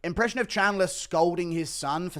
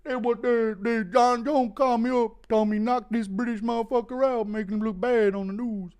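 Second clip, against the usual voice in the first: higher, louder brash fighter voice; 9 semitones higher, 4 dB louder than usual. Acted. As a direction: boast to the crowd like a post-fight brawler.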